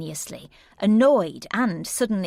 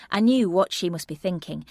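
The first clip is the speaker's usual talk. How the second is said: usual speaking voice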